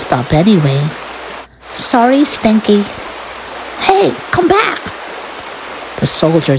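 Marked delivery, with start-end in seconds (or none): 1.7-2.8 higher-pitched breezy brush-off; raised little soldier voice; cheeky toy soldier. Acted. Soldier sails past the rat. 3.9-4.8 very high squeaky call; high-pitched rat protest; indignant rat calling. Acted. Rat calls after the soldier.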